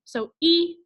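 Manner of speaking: "E" said as a long ee, the vowel saying its name. Shown as a demonstration. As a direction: stretch "E" into a clear long ee. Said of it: "it can say its name".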